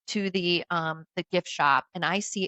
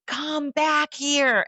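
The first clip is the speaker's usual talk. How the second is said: slow, high, drawn-out call; imitated scream; 7 semitones higher, much slower than usual. Storyteller imitates man screaming after her on beach, drawn-out yell. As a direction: yell it as his drawn-out demand. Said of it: "He's screaming".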